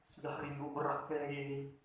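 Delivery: softly voiced grief, a touch of crying; quiet, sorrowful, understated weeping; mild tearful lament. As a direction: murmur it like a boy holding back tears. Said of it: "Jadi menangislah".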